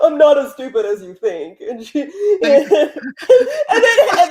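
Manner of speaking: breaks into real laughter mid-sentence; can't get the words out for laughing, pitch climbing; giggling helplessly while telling the story; laughing through it. Real, not acted. Cracks up telling the scene.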